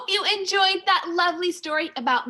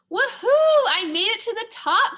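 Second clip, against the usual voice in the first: high, loud cheer of triumph; whooping with joy, much higher and louder; excited shout; 7 semitones higher, 5 dB louder than usual. A narrator's reading. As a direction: shout it out from the top of the wall. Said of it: "Sophia shouted".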